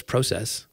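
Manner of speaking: "Process" with a long O, PROH-sess; Canadian pronunciation. Retold or imitated. Teasing a Canadian friend's speech, "Process" with a long O. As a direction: say "Process" the Canadian way, first vowel as in "pro". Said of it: "He says".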